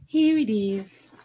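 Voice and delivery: high, slow sing-song "Here it is"; playful reveal. Real, not acted. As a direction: stretch it out, teasing the viewers.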